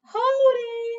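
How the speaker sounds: Hodi and Karibu called high and loud; calling greeting. Acted. Child and shop owner greet, high and loud. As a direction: sing out the greeting at the door.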